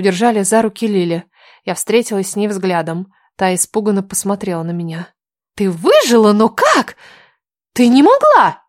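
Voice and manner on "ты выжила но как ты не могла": high, loud shocked shout. Acted. Lily shouting at the heroine in shock, loud and high. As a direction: cry out in disbelief. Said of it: "крикнула она".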